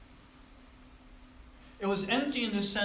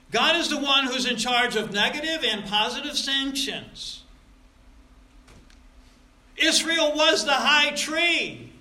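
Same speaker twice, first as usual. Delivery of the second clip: rising, louder proclamation, slowing at the end; forceful preaching; 6 semitones higher, 7 dB louder than usual. Acted. Preacher pressing the congregation on God's sanctions. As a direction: build the point to a peak.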